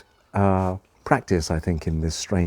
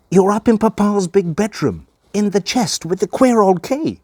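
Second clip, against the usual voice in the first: put-on high, excited child voice; gleeful boy's guess; 13 semitones higher, 5 dB louder than usual. Boy guessing where grandma hides, high and gleeful. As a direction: guess it eagerly, pitched way up. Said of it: "he would cry and laugh with glee".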